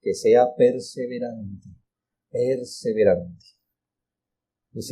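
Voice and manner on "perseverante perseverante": perseverante drawn out slowly, low-key; slow, measured weight on each "perseverante"; emphasis on perseverante. Shown as a demonstration. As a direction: say "perseverante" slowly and deliberately, letting it sink in. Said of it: "cuál es el énfasis".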